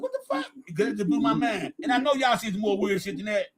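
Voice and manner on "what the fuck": much higher, retold disbelief; shocked quote. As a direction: ask it like you can't believe your ears. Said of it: "I said".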